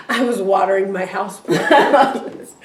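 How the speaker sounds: normal talking voice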